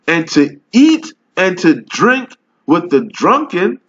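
slow, solemn King James recital; scripture reading. Acted. Street preacher reads the Bible to his video audience, solemn slow recital. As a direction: read the verse like preaching it from the pulpit.

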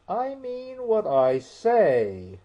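high, loud, slow, stiffly offended; indignant, drawn-out. A narrator's reading. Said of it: "in an offended tone".

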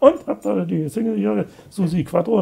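high, eager innocent question; mock-naive cheerful ask, pitched high. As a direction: ask it like a carefree kid in a record shop. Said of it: "so naiv wie ich bin und fröhlicher Mensch".

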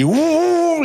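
high, loud vocal rev of an engine; mock engine roar. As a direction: imitate the truck revving up. Imitating the engine revving. (imitación del rugido del motor, aguda y fuerte)

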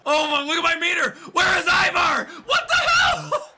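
high shouting climbing to a squeal; frantic gamer outburst, voice shooting up; panicked disbelief. Real, not acted. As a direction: yell for help in rising exasperation. Panicking as partner is absent.

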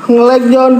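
high, urgent alert; alarmed. Real, not acted. Ghost hunter warns John of a lag, high and alarmed.

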